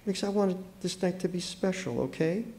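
high and very soft, near tears; tearing up. A narrator's reading. Wife whose special night is spoiled, tearing up. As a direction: plead softly, voice small and hurt. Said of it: "tearing up".

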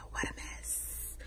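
soft unvoiced whisper, slowly. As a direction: breathe it out as a slow whisper. Whispered, then repeated aloud.

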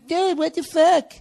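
high, slow whiny dude voice; hammed-up whiny complaint; mock annoyed fan. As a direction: imitate a complaining listener. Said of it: "giving me shit".

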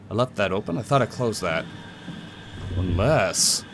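ordinary talk

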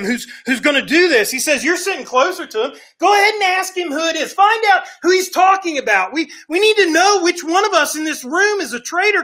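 urgent insistence. Retold or imitated. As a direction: press him hard to go ask. Peter urging John to ask.

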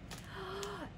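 long, soft gasp; breathy gasp. A narrator's reading. Toucan straining with his party load, soft gasp. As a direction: suck in air before speaking.

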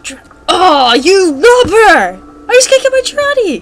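loud, high-pitched whining outburst; frustrated wail. Real, not acted. Player just can't get Girardi, frustrated wail.

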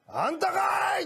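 high shouted tsukkomi; comic retort. Retold or imitated. Nakamoto blurting at the masseuse, high shouted surprise. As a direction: yell the punchline in disbelief. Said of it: "思わず中本が言ってもうたら".

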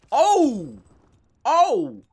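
loud, high startled exclamation; surprised gasp. Real, not acted. Streamer reacting to an unexpected drop, loudly surprised. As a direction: blurt it out, caught off guard.

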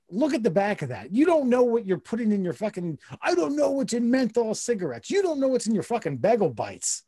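fed-up comic tirade, pitched high; exasperated rant. Real, not acted. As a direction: rant at the clueless complainer, worked up. Exasperated rant about the lawsuit.

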